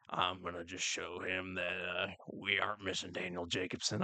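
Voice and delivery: a hint of a Southern accent, low and cocky.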